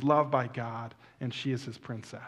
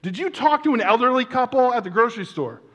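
high, loud, acted incredulous question; urgent questioning; 9 semitones higher, 8 dB louder than usual. Church friend phoning to question him, acted urgency. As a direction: quiz him urgently, pitch well up.

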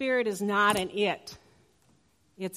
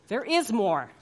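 emphatic repeat; 3 semitones higher, 8 dB louder than usual. Pretend. Repeating the infomercial line.

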